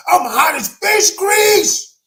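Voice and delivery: high, loud, slow angry outburst. Retold or imitated. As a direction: let the retold fury burst out. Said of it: "I am angry".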